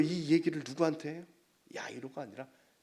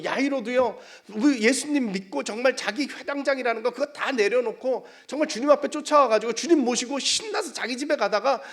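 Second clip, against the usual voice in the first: loud, high, driving sermon voice; impassioned preaching; 11 semitones higher, 11 dB louder than usual. Real, not acted. Preacher retells Jairus's story to congregation, impassioned preaching. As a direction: preach it out with force.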